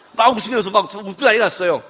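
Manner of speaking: ordinary delivery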